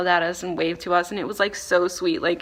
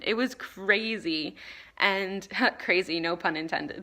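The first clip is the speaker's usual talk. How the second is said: ordinary talk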